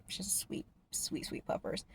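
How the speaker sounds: soft breathy whispered cooing. Real, not acted. Gushing over the dog she loves, whispered baby talk. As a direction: coo it like talking to a puppy.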